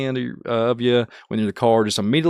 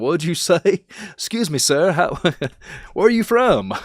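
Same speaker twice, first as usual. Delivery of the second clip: confused stranger; 8 semitones higher than usual. Retold or imitated. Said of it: "people are like".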